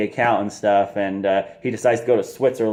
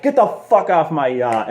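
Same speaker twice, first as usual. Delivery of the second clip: higher, mock-angry order off the boat; mad Belfort; 6 semitones higher, 4 dB louder than usual. Belfort ordering the agent off his yacht, mock angry. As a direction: play the furious host kicking him out. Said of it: "Belfort gets all mad and saying".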